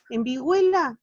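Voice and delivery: vihuela with the E said loud and strong; stress on E. Shown as a demonstration. Teacher showing students the stressed vowel. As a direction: punch the E in vihuela. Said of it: "la E suena fuerte".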